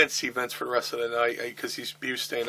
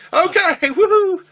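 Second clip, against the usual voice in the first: much higher and louder whooping cheer; celebrating; 12 dB louder, slower than usual. Retold or imitated. Beer in each hand in the ring, a loud whooping cheer. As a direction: whoop it like a party.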